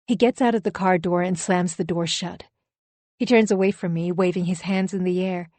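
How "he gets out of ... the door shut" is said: ordinary talk, nothing marked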